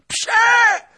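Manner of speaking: loud high rage climbing to a howl; howled accusation. A narrator's reading. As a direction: hurl the charge, then howl the final insult. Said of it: "zawył Tuchaj".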